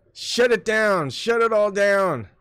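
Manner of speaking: mock shout. Mock dramatic shutdown call.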